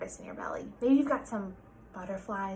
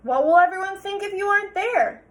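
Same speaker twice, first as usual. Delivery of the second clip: higher, louder father voice; coaxing dad; 9 semitones higher, 8 dB louder than usual. Acted. Father coaxing Sarah out of bed, higher and louder. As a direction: reason with her.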